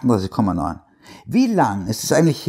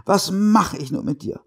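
tearful; 9 semitones higher than usual. Acted. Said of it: "sagte sie weinend".

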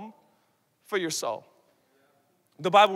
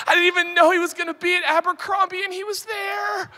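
high, fast, excited squeal with giggle; giddy squealing girl; 8 semitones higher, 4 dB louder than usual. Retold or imitated. Preacher mimics a teen girl gushing over a crush, high giggly squeal. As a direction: gush like a smitten teen girl. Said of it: "You're like".

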